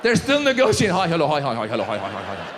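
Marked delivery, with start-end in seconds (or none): 1.0-2.5 bow lower each time you greet; descending mock greeting. Bowing Persian greeting bit.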